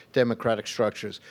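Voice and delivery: ordinary talk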